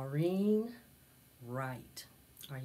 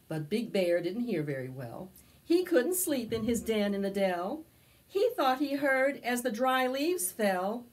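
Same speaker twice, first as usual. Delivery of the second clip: storybook narration; 4 semitones higher, 6 dB louder than usual. A narrator's reading. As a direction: tell it to a circle of little kids. Storytelling continues.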